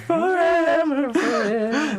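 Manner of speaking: high drawn-out wailing forever; ghostly echo. Pretend.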